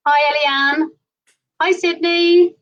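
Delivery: ordinary talk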